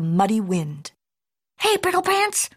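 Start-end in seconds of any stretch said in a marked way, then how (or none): 1.6-2.5 high-pitched holler; hollering mama. A narrator's reading. Mama cat calling her kitty boy, hollering. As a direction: call out high across the yard. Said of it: "hollered Mama".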